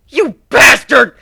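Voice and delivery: loud, high, slow furious shout; furious outburst. Acted. Drone screaming at the griffin who killed her kin. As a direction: scream your hatred at him. Changeling hears comrades were killed.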